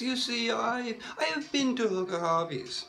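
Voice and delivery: normal talking voice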